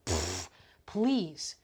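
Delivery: scornful pfft, please, voice dropped low; dismissive scoff. Real, not acted. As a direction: brush the idea off with a scoff. Scoffing at giving away power.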